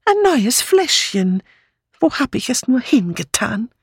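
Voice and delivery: put-on high, fretful old lady searching; fussing old aunt. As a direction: sound like a flustered elderly aunt hunting for her bottle.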